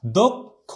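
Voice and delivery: দক্ষ split as "dok... kho"; closed O pronunciation. Shown as a demonstration. Teacher giving students the pronunciation, দক্ষ as "dok-kho". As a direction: sound out দক্ষ in two parts with rounded o. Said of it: "এটার উচ্চারণটা হয় তোমার".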